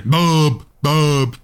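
half-hearted yell after someone; a mild imitation of shouting after him; mock calling out. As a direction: call the short name, drawn out. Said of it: "jak on wrzeszczy za nim".

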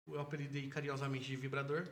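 ordinary talk, nothing marked

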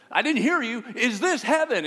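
rally the crowd like a host on a field; calling out to crowd. Retold or imitated. The actor calls to the crowd at the ballgame, loud and amused.

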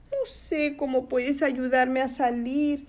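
high little creature voice; tiny thin voice. Acted. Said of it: "la voz finita". (vocecita finita y aguda)

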